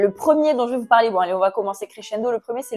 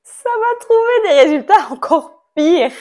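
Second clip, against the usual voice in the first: laughing dread; 5 semitones higher, 6 dB louder than usual. Real, not acted. Dreading the search results.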